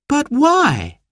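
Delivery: put-on high, childlike query; puzzled child. A narrator's reading. Girl questions parents about the party, puzzled, high. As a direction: pitch it right up, wondering why.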